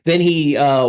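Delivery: ordinary delivery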